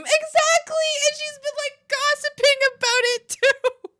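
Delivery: high, loud indignant squeal; outraged exclamation. Real, not acted. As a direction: burst out in disbelief, way up high. Outraged at gossip about her.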